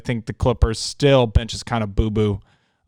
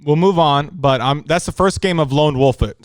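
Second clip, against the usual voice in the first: usual speaking voice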